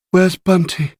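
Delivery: put-on high-pitched young woman's question; anxious waking patient. A narrator's reading. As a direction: ask high, just woken in hospital. Patient wakes, asks after friend.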